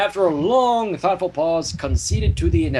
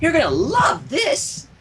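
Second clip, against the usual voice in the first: slow, higher, shouted glee; excited Pinky; 11 semitones higher, slower than usual. Acted. Pinky showing off her surprise, higher excited shout. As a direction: belt it out with glee.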